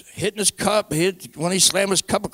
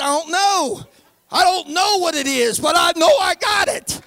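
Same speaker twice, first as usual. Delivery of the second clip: tearful high shout; retelling a tearful cry; 9 semitones higher than usual. Retold or imitated. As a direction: cry it out as you relive the moment. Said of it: "all I could do was cry".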